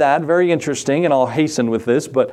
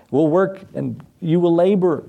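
ordinary talk, nothing marked

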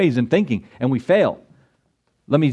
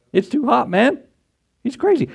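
mocking son; 9 semitones higher than usual. Son scoffing behind father's back.

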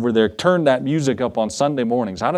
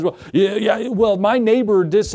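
higher-pitched mock whine; hammed-up petty complaining, pitched up; whining complainer; 6 semitones higher than usual. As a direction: imitate a griping neighbor at a meeting. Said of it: "a bunch of whining and complaining".

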